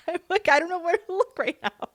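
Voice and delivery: high-pitched giggly voice; laughing while talking. Real, not acted. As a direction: talk through a giggle fit. Laughing while retelling friend's hiccups.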